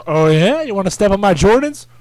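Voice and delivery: put-on loud, rising tough-kid taunt; imitated fight challenge; mock indignant challenge. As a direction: act out a loud, affronted fighter. Acts out puncher taunting his victim.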